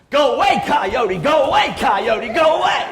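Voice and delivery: high, loud shouted warning; demo yell. Shown as a demonstration. Showing the audience the ranger's method, a full high shout. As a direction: shout the coyote away at full force. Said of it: "this is what you're supposed to do".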